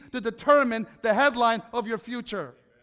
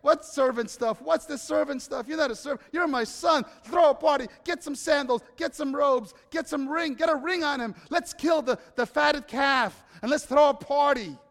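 joyful father calling out, high and loud; overjoyed, cut off the apology; excited father; 5 semitones higher, 6 dB louder than usual. Acted. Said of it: "the father interrupts him and says".

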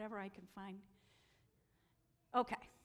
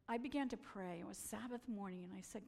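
ordinary delivery

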